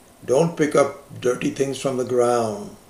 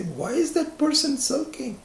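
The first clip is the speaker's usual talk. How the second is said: puzzled question; 13 semitones higher than usual. Retold or imitated. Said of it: "we say".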